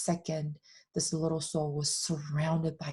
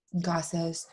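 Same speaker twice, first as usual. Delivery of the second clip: normal talking voice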